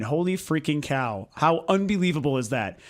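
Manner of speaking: ordinary talk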